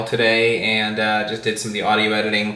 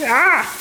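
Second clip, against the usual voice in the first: sudden high "ah"; 4 dB louder than usual. Real, not acted. Sudden realisation, high exclamation.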